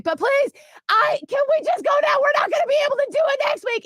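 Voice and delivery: put-on high-pitched urgent child's plea; pleading child. Daughter begging mom in the car, pleading.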